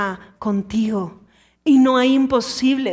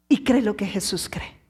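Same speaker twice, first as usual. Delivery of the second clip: normal talking voice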